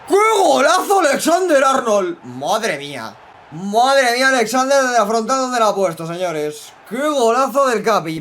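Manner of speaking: high, loud excited goal call; breakneck goal commentary. Acted. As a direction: shout the goal like a live commentator.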